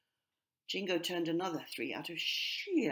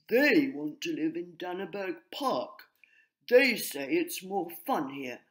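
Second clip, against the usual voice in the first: louder, self-important pigeon voice; grey pigeon pressing for action; pompous pigeon; 7 dB louder than usual. A narrator's reading. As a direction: puff up and lecture the chief.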